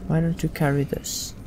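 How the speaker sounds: ordinary delivery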